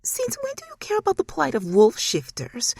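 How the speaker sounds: high, otherworldly mockery; high eerie demon scoff; amused demon mother. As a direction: laugh at her through the words. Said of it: "Abera laughed".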